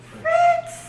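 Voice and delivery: high delighted cry of a name; thrilled fan shriek; excited squeal. Real, not acted. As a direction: squeal his name in joy. Excited greeting of game character.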